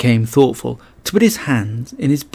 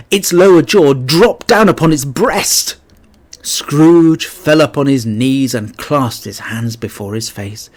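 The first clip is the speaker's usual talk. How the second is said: usual speaking voice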